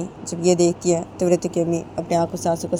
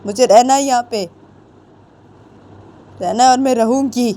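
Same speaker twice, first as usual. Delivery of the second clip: angry refusal; 4 semitones higher than usual. Acted. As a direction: shout back that she won't go. Daughter angrily refuses to leave. (पिता पर चिल्लाती ज़िद्दी बेटी, ऊँची तेज़ आवाज़)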